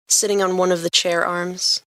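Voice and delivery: ordinary delivery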